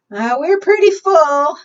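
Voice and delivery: hesitate, the canoe is full; fretful girl. Acted. Girl telling the bear there's no room, worried.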